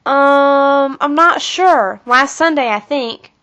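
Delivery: slow, puzzled, searching for the answer; unsure, hesitant. A narrator's reading. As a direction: think it over out loud, high and halting. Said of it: "Kathy wrinkled her brow".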